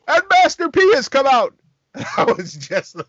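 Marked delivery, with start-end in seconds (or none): 0.1-1.5 high, loud excited call; announcer call. Retold or imitated. Imitating Tony Schiavone calling the run-in, a loud excited call. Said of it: "all you hear is Tony Schiavone say".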